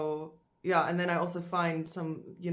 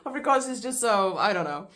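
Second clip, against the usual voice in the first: high, loud, laughing through the words; 7 semitones higher, 8 dB louder than usual. Real, not acted. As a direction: burst out laughing about Afrikaans.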